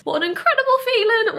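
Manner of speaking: high, loud and giddy with relief; elated relief. Real, not acted. Vlogger giddy after her housing problem is solved. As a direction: let it all spill out happily.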